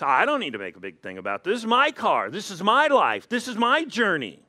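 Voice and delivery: mock-defiant rant with swooping pitch; defiant driver. Pastor mimics driver refusing advice, mock defiant. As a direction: insist on it, hammering each short phrase.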